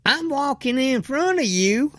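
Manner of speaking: high, snippy imitation; mocking the lady. Mocking the lady at Walmart, pitched high. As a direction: parrot her line in a high mock voice. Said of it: "and she says".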